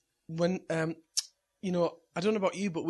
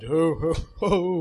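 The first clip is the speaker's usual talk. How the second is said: loud, booming Santa ho-hos; 2 semitones higher, 9 dB louder, much slower than usual. Retold or imitated. Santa dad at the children's bed, booming ho-hos. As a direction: boom it out as Father Christmas. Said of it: "I walked over to the bed and went".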